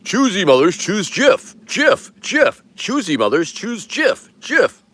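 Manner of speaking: high, loud TV commercial announcer; ad jingle parody. Retold or imitated. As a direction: sell it like an old peanut butter ad. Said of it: "I totally meant peanut butter".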